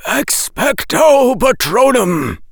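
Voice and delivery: loud, slow, booming incantation; powerful elder wizard casting a spell; deep-voiced headmaster. A narrator's reading. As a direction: shout the spell with full authority. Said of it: "said the headmaster's deep voice".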